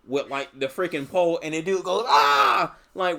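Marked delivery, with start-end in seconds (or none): 2.1-2.8 high, loud imitated yelp; mock pained scream. Henchman poked in the eye with a pole, imitated high scream.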